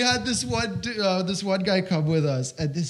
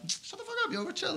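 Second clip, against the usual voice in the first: much higher, hushed and urgent; high, softer, telling friends to hush; high hissed hush; 7 dB quieter, faster than usual. Retold or imitated. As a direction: squeak it to shut your friends up. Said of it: "I'm like".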